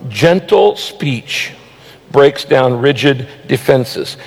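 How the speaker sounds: drawn-out, very slow repeat of the verse. Shown as a demonstration. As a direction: stretch every word of the verse. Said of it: "Gentle speech can break down".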